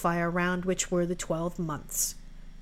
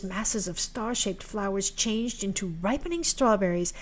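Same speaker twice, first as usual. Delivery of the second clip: ordinary delivery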